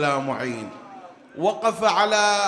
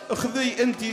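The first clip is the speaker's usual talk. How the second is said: higher pleading voice; 6 semitones higher than usual. Acted. Mother's plea voiced.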